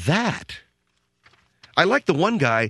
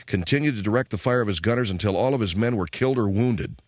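measured, solemn reading of a medal citation; formal citation read; 4 semitones lower than usual. Acted. Host reads a hero's citation aloud, measured and formal. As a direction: read it like an official commendation.

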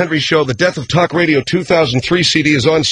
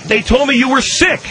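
higher-pitched excuse; defensive; 9 semitones higher than usual. Acted. Host explains himself to upset sidekick, defensive. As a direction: protest your innocence.

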